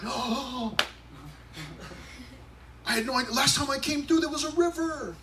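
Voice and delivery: high, put-on voice of someone caught off guard; fake-innocent high surprise; mock surprise. Pastor imitating a God who forgot the river, high mock surprise.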